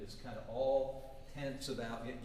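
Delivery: usual speaking voice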